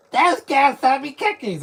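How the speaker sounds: mock an actor's put-on nerd voice; nerdy voice impression. Mocking the actor Josh's nerd voice, high loud mock nerd. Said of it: "That's not a voice".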